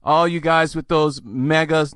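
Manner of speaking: ordinary talk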